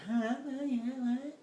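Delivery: high, baffled woman's stammer; dumbfounded woman. Retold or imitated. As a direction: play her caught off guard, voice jumping up. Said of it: "kinda dumbfounded".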